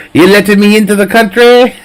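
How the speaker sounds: a deliberately bad Mexican accent, hammed up; crappy Mexican accent. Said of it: "What a crappy Mexican accent I've got".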